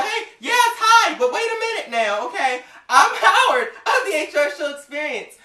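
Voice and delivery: high, loud, breathless rush of words; excited eager intro. Retold or imitated. Fan introducing himself to his idol's daughter, eager and high. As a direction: jump in before she hangs up.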